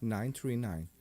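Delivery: slow, soft, dramatic number read. Shown as a demonstration. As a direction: ease into the number quietly. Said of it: "now for the crescendo".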